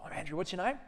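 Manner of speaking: bright friendly introduction pitched high; gentle, high, friendly. Retold or imitated. Greeting a drunk heckler, high and gentle. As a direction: disarm him gently with a high, friendly hello. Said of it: "just gently".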